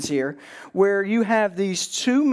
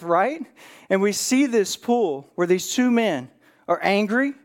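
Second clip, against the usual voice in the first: ordinary talk, nothing marked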